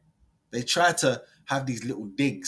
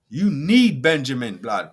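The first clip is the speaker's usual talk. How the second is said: ordinary talk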